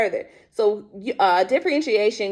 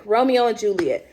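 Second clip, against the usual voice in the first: ordinary talk, nothing marked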